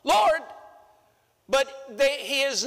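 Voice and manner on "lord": high loud "Lord, Lord, Lord" like hands raised in worship; imitating a fervent churchgoer; imitating worshippers. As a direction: cry out like a worshipper in a pew. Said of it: "they're lifting their hands and they're going".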